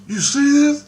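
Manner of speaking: high, loud burst of disbelief; imitated astonished question. Man asking about the spinning waters, mock astonishment. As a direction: shoot the pitch up, mimicking his amazement. Retells the man's startled question.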